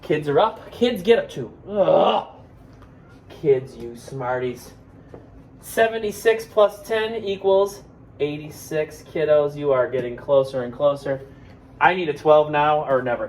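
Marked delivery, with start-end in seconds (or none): none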